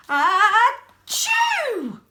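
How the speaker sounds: loud, high hammed-up sneeze; big sneeze. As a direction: burst out with a huge sneeze. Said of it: "He blows and he sneezes".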